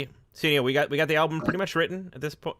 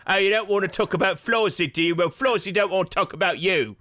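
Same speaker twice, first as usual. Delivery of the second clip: taunting vacuum; 6 semitones higher than usual. Pretend. Voicing the vacuum, singsong taunt.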